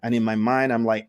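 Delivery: ordinary talk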